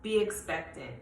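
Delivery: a soft, tender charge to the listeners; gentle word from the Lord. Acted. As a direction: pass on the Lord's word quietly. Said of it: "But the Lord says".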